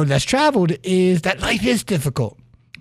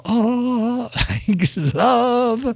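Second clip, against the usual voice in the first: mock sappy; 5 semitones higher than usual. Mocking a sappy reaction.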